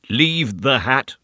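slow, very distinct, muffled; distinct through a cloth. A narrator's reading. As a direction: say each word clearly through cloth.

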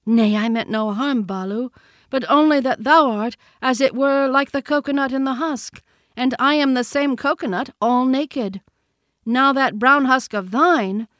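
put-on high, loud boy's voice; Mowgli the boy, explaining. A narrator's reading. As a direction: explain it eagerly, pitched high.

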